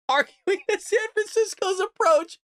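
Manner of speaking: high, drawn-out reading through laughter; real laugh pushing the voice way up; cracking up. Real, not acted. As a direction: read it while losing it laughing. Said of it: "I am laughing".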